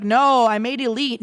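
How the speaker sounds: normal talking voice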